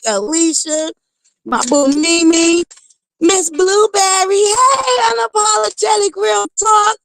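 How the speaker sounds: high, drawn-out sing-song name calling; roll call shout-outs. Real, not acted. Livestream host calling out viewer names, high and sing-song. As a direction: greet each viewer like a bright announcer.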